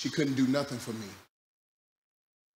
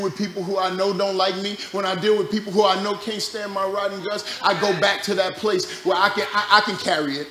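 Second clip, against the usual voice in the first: rising, louder preaching; 5 semitones higher, 7 dB louder than usual. Real, not acted. Preacher lifting the congregation, getting louder. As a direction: build the sermon up. Sermon building to climax.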